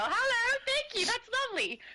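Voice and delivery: high, cheery greeting; gushing thanks. Pretend. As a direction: thank a fan in a high, sweet voice.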